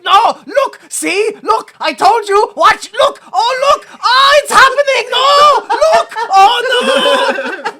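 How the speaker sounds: high, loud, desperate half-crying shouts; frantic pleading. Retold or imitated. Villain begging the fighters to watch, shouting. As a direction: beg them to look, frantically. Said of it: "he's like".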